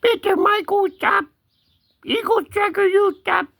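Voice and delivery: high-pitched put-on cat talk. Cat telling the pesterers to stop, high cat voice. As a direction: speak up high as a sleepy, protesting cat. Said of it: "the only cat voice I have".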